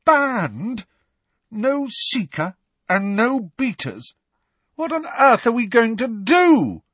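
high, loud, despairing wail; high-pitched lament at hopeless news; hollow dismay. A narrator's reading. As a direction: cry out in hollow disbelief. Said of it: "said Angelina in a hollow voice".

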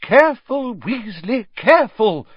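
high, loud teacher's warning; alarmed cry. A narrator's reading. Professor Sprout warning Weasley as beans spill, crying out. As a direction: cry out sharply, pitch leaping up. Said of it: "cried Professor Sprout".

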